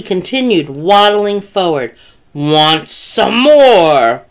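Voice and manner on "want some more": slow, drawn-out spooky call; eerie calling. A narrator's reading. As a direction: stretch every word as you lumber forward. Tacky waddles on, calling to bear.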